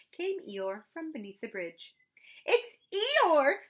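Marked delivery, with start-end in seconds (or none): 2.4-3.6 high, loud excited cry; terribly excited. Acted. Roo shouts to his friends, terribly excited. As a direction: Roo can hardly contain himself. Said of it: "cried Roo, terribly excited".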